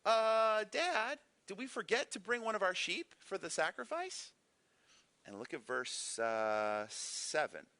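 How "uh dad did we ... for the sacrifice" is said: lightly voiced boy, pitched higher and asking; a kid innocently wondering where the lamb is; curious boy Isaac. Son Isaac puzzles to his dad over the missing sheep, higher, curious. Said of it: "He's like".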